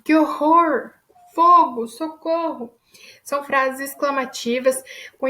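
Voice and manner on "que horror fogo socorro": high, louder cries of alarm; expressive exclamation. Shown as a demonstration. Teacher demonstrating exclamations to the class, alarmed and loud. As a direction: call out as if the house is on fire. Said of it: "entonação expressiva".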